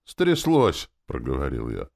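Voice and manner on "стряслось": high, loud confirmation; exclaimed with force, pitch way up; heated retort. A narrator's reading. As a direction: throw the word straight back, loudly. Snaps back at smirking friend.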